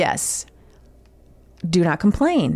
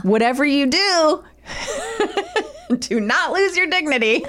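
laughing, raised pitch; reading through laughter; 5 semitones higher than usual. Real, not acted. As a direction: crack up while giving the advice. Cracks up reading survival advice.

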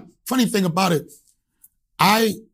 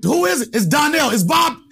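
much higher, louder brash bluff; cocky; 11 semitones higher, 4 dB louder than usual. Retold or imitated. Caller bluffing his name to the receptionist, brash shout. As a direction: bluff your way past her, loud. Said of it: "I'm gonna act like cocky".

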